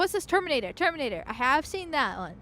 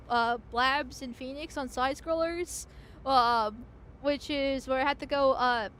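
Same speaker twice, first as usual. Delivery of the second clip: ordinary talk, nothing marked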